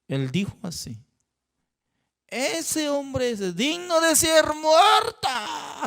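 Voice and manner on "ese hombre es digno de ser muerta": high, slow furious shout; angry shout. Acted. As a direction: roar out the death sentence in rage. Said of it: "el rey David se enojó".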